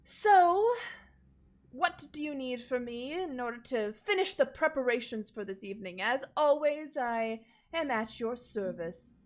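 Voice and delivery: eager servant, loud and high at the start; lightly voiced attentive manservant; butler character. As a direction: announce yourself at his service. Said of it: "He says".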